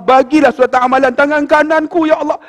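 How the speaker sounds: high, rising plea to God; imitated tearful prayer; tearful pleading. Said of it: "Sambil duk basuh tu teriak".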